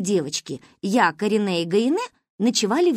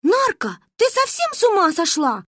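put-on higher, louder, cross girl's voice; annoyed Manka; 11 semitones higher, 3 dB louder than usual. A narrator's reading. Manka scolds Narine for kicking in her sleep, an annoyed, higher voice. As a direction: scold your sleepy friend. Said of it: "Манькиным голосом рассердился леший".

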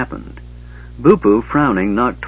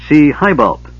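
loud, lofty and pleased; proud; 5 semitones higher, 5 dB louder than usual. A narrator's reading. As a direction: say it with puffed-up pride. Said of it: "Bupu said proudly".

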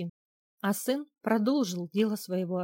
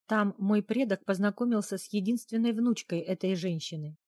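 usual speaking voice

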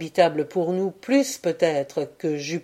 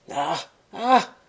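plaintive cry. A narrator's reading. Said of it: "cria la voix plaintive". (deux cris plaintifs, plus aigus et plus forts)

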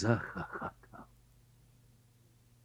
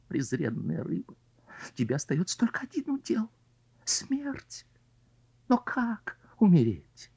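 despairing carp voice; 7 semitones higher than usual. Acted. Carp's despairing monologue.

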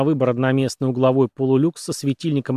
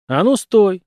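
raised, loud command to stop; higher, irritated order; sharp order; 7 semitones higher, 5 dB louder, slower than usual. A narrator's reading. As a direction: halt someone angrily. Director grabs Viking to stop him.

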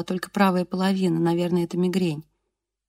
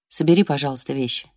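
low male voice; 5 semitones lower than usual. A narrator's reading. Husband voiced low in narration.

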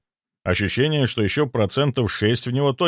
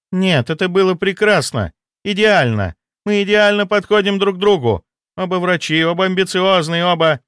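high, insistent lover's voice; desperate mistress; 9 semitones higher, 4 dB louder than usual. Acted. Spurned mistress insisting they are perfect together, high and desperate.

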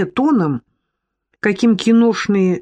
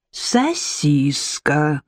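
«Сосиска» drawn out very slowly and lower; patient repeat; 4 semitones lower, much slower than usual. A narrator's reading. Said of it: "терпеливо повторил".

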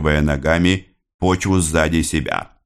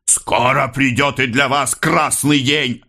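higher-pitched, defiant warning; raised voice; 7 semitones higher than usual. A narrator's reading. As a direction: raise the voice to warn the oppressors. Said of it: "возвышая голос". (возвысив голос, с угрозой)

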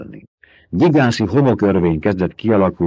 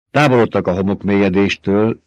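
usual speaking voice

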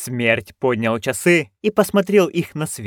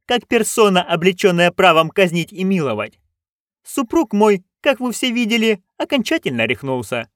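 imperious duchess; 7 semitones higher than usual. Acted. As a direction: lay down the law as the duchess. Duchess voice held.

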